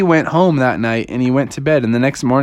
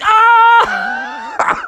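high scream of pain; high-pitched cartoon scream; Froggy. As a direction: shriek as if zapped. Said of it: "he's like".

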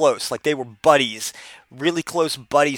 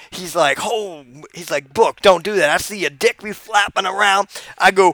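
Booker T impression; 2 semitones higher than usual. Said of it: "You got any more stories".

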